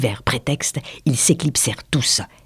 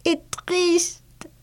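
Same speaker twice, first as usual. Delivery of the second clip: high, soft sighed words; small, sighing mouse; 5 dB quieter than usual. A narrator's reading. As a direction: say it sadly on a sigh. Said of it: "en exhalant un soupir".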